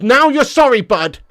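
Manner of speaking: high, shouted "now you're sorry"; loud taunt. Real, not acted. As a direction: throw it back loudly at a cheeky viewer. Repeats taunt much louder, higher.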